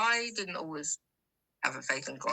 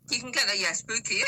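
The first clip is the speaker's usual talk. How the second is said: usual speaking voice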